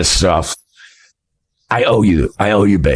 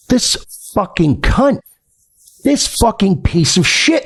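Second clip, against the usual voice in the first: imitating a livid friend, higher and slow; mock seething, each phrase drawn out; livid, retold; 5 semitones higher than usual. Streamer quoting his livid friend, mildly acted anger.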